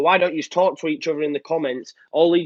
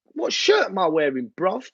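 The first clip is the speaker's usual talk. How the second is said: lightly mocking voice, pitched up at the end; jokey imitation, rising on "bruv"; put-on bruv voice; 5 semitones higher than usual. Jokey put-on voice, then apologises.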